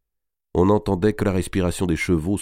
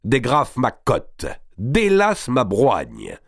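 slow, raised-pitch orders; commanding; 5 semitones higher, much slower than usual. A narrator's reading. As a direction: order the valet with lordly force. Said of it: "commanda-t-il à son valet". (ordres impérieux, lents et appuyés)

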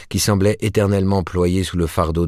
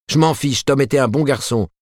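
much higher, lightly voiced woman, a touch of defiance; wife standing up to husband, defiant; 9 semitones higher, 3 dB louder than usual. A narrator's reading.

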